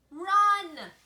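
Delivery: high, loud shout of run; yelled warning. A narrator's reading. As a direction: scream at mom to flee now. Bright Bill warns his mother.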